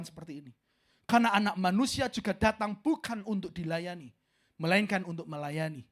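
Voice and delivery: loud, measured Bible reading; scripture reading. Acted. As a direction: read the verse out with weight. (membaca ayat dengan lantang)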